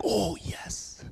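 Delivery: breathy, high, sarcastic 'oh yes'; mock excitement. Kids mocking the leaders' instruction, sarcastic. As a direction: play the kids faking thrill. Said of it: "And they're like".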